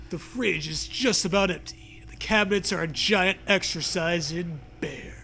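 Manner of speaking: ordinary talk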